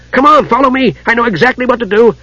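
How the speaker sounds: high and eager, fast; excited. Acted. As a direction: hurry him along thrilled. Tas eager to lead.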